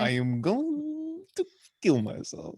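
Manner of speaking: high, very drawn-out cartoon villain impression; hammed-up high accented voice, held long on one note; Gru. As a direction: stretch it slowly in a comic thick foreign accent. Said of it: "in the Groove voice".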